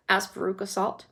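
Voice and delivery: usual speaking voice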